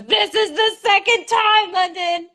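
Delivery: high, slow, fed-up half-asleep roommate; exasperated roommate. Retold or imitated. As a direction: mimic the tired roommate scolding you. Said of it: "she goes".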